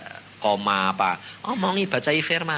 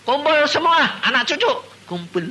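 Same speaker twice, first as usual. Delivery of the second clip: summoning; 12 semitones higher, 6 dB louder than usual. Retold or imitated. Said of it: "mother wakes, gathers family".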